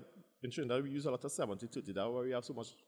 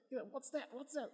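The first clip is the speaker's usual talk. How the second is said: mock puzzled questioners; 13 semitones higher, 4 dB quieter than usual.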